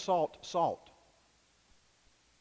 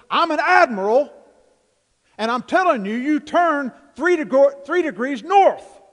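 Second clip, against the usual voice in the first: very loud, high insistent order, slowing at the end; admiral shouting; 11 semitones higher, 16 dB louder than usual. Retold or imitated. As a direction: pull rank and shout it out. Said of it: "finally the admiral said".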